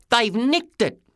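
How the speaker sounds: put-on high-pitched kid; high, outraged young lad; indignant boy. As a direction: accuse them in a high boyish voice. Boy character accuses the thieves.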